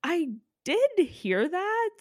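high, slow, knowing reply. Real, not acted. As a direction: drag it out, all-knowing. Knowing reply about straight judge.

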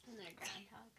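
soft whispered aside. Real, not acted.

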